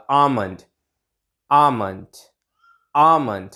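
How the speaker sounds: आमंड with a silent L, falling; correct almond pronunciation. Shown as a demonstration. Teacher drilling the correct pronunciation, silent L. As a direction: model आमंड slowly as separate repeats, no L. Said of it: "एल का sound silent रहेगा".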